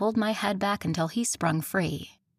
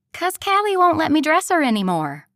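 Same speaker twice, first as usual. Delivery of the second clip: much higher, bright insistent friend; bubbly friend voice; 9 semitones higher, 9 dB louder than usual. Acted. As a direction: play the bossy best friend. Evie complaining, voiced high.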